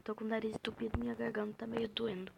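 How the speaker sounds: real hoarse voice, half whispered and soft; talking through a sore throat and blocked nose; sick, croaky voice, sparing the throat; hoarse from a cold. Real, not acted. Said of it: "desculpa se eu tô meio rouca".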